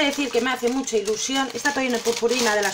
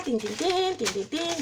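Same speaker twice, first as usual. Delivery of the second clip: sung fanfare; 7 dB quieter than usual. Acted. Fanfare sound while opening.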